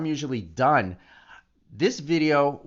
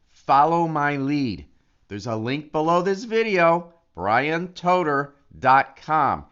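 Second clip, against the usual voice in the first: emphatic pitch; 3 semitones higher, 6 dB louder than usual. Real, not acted. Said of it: "call to action".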